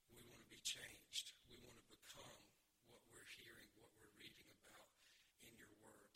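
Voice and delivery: low, soft, unhurried prayer; hushed prayer with pauses between phrases; public prayer. Real, not acted. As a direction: talk quietly to God on the congregation's behalf. Leads an opening prayer.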